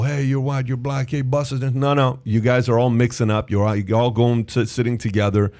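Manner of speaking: normal talking voice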